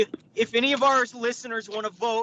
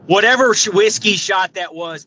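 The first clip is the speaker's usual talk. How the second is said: emphatic complaint; 13 dB louder than usual. Real, not acted. Complaining about the awful whiskey.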